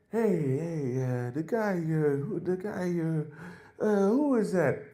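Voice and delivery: a hint of a confused cab driver; Uber driver. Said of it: "the Uber driver's like".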